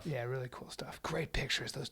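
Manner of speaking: soft unvoiced whisper. Real, not acted. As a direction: whisper it quietly. Hushed after loud talk.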